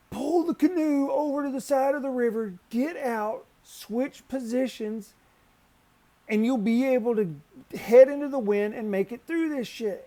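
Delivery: high, loud orders yelled over the river; shouted instructions. Retold or imitated. Yelling directions to Eric in the spinning canoe, loud and high. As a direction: bark the steps so he hears. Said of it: "I'm like".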